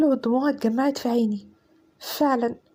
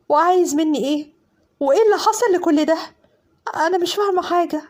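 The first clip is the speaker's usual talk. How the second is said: frightened demands; 3 semitones higher than usual. Acted. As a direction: demand answers, near tears. Terrified bride questions silent captor.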